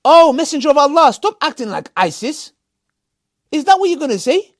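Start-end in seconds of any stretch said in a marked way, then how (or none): 0.0-2.5 mock retort. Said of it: "What are you gonna say?".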